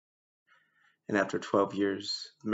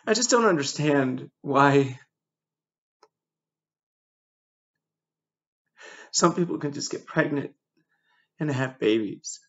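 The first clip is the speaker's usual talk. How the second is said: pained frustration; 4 semitones higher, 4 dB louder than usual. Real, not acted. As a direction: let the hurt rise as you question it. Bitter grief over infertility.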